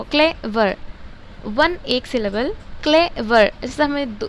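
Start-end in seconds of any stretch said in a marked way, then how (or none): 0.0-0.8 clever split in two, clay, pause, वर; pause between syllables. Shown as a demonstration. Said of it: "दो दो word के बीच में pause हो रहा". 2.8-3.5 clever split in two, clay, pause, वर; pause between syllables. Shown as a demonstration. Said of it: "इसको बोलते दो syllable बोलते".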